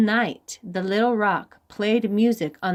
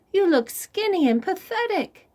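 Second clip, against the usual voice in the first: much higher, small voice; high little chameleon voice; 11 semitones higher than usual. Acted. Chameleon teasing Rabbit in a high little voice. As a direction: pity him in a tiny high voice.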